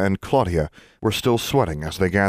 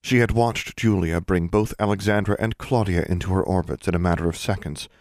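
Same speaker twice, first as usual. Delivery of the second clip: ordinary delivery, nothing marked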